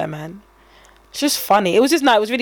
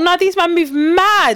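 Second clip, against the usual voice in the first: indignant; 8 semitones higher, 3 dB louder, slower than usual. Retold or imitated. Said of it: "But then I thought".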